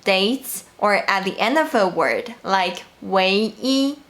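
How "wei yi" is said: "yi" held level in first tone; original first tone. Shown as a demonstration. Teacher shows yi at the end of a word, first tone. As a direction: say "wei yi" keeping "yi" in first tone. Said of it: "it is pronounced at its original tone, which is the first tone".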